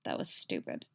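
very low, gruff rebuke. A narrator's reading. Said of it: "he says gruffly".

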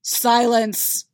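booming roar of Silence. Acted. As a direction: bellow it to silence the room. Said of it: "finally roars".

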